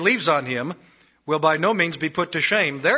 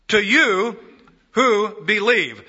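slow, louder emphatic preaching; preaching emphasis; 5 semitones higher, 6 dB louder, slower than usual. Acted. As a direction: land each word on the listener.

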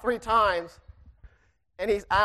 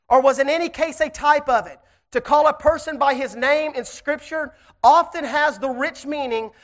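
louder, higher-pitched preaching to the congregation; 4 semitones higher, 4 dB louder than usual. Acted. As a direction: lift the church with a rising sermon. Preaches to the church.

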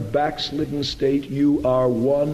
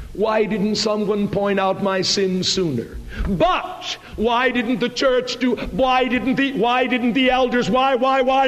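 high-pitched, insistent blame-shifting; mock complaining; 7 semitones higher than usual. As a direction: imitate a protesting congregant, climbing higher. Mimics congregant blaming others.

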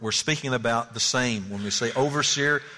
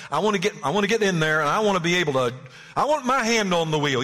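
eager, rushed, higher-pitched grab for power; mock selfish ambition; 4 semitones higher, 3 dB louder, faster than usual. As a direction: imitate someone pushing to take control. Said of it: "it should not be out of selfish ambition".

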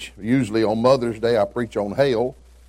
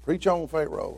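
sweet churchy encouragement; 6 semitones higher, 3 dB quieter than usual. Retold or imitated. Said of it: "like that".

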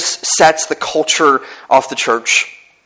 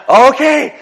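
startled okay; 13 semitones higher, 7 dB louder than usual. Retold or imitated. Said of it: "I'm like".

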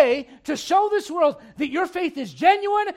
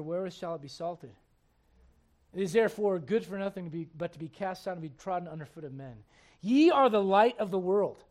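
scripture reading; 4 semitones lower than usual. Acted. Reads scripture aloud.

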